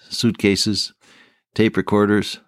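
usual speaking voice

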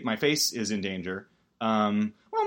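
usual speaking voice

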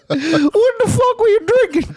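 high, laughing, put-upon host scolding a guest; disgruntled host voice. Acted. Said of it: "That's my disgruntled fucking host voice".

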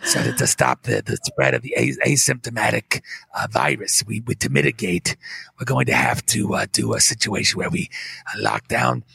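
detached and boring, full of hesitations; low droning official with long uhs; Fauci impression. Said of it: "but he's going".

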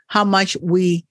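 normal talking voice

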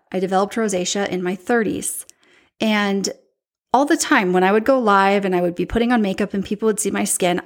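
ordinary talk, nothing marked

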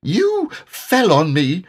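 high, slow squeaky complaint; tiny high character voice; Piglet's little voice. A narrator's reading. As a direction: pipe it up slowly in a small voice. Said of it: "said Piglet".

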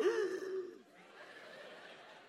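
a big high gasp sucking the air in, half laughing; sharp gasp. Retold or imitated. Pastor mimics Green Mile guy absorbing everything, big laughing gasp. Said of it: "He's like".

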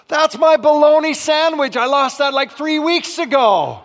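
loud, high shocked yell; a loud cry of disgusted recognition; surprised shout. Retold or imitated. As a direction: yell it like you just found it. Shocked discovery of old sandwich.

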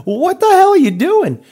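incredulous shout at the contractor; retold outraged yell. Second confrontation with contractor.

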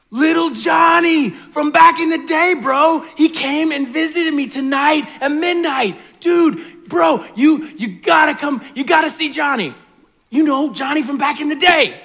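loud, high excited bro shouting; frat bro. Acted. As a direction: yell like a hyped frat bro at midnight. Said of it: "this is how the story goes".

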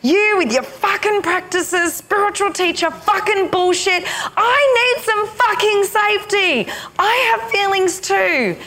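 high angry tirade, wounded; furious inner feminine. Retold or imitated. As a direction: rage at the spiritual teacher. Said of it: "she was furious with me".

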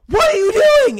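high, loud shriek of surprise; shocked mom. Retold or imitated. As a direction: shriek at the kid who walked in. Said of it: "she's like".

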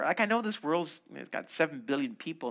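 ordinary talk

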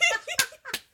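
squealing laughter; high laugh. Real, not acted.

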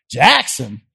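dismissive Nashville snob; snooty scorn. Retold or imitated. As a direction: look down your nose at it. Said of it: "we thought everybody here was hoity-toity".

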